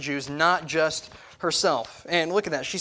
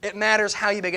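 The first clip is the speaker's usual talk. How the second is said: ordinary talk, nothing marked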